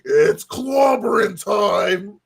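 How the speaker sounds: long, drawn-out battle cry in a put-on voice; slow hammed-up rallying cry; the Thing's catchphrase. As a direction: stretch it out like a hammy comic-book hero.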